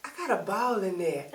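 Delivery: put-on quick, proud little boy voice. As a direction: announce it like a proud kid. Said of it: "And he was like".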